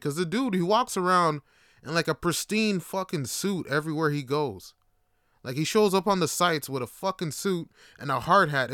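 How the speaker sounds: ordinary talk, nothing marked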